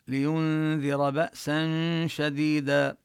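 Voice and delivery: slow recitation, hamza in بأسا sounded clearly. Shown as a demonstration. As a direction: say بأسا with a clear glottal stop. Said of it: "نلفظها من مخرجها بشكل واضح".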